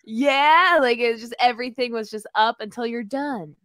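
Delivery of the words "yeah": "yeah" stretched and lifted in pitch; overdramatized Canadian rise. Retold or imitated. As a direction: exaggerate the upward lilt on "yeah". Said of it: "over dramatized the Canadian rise".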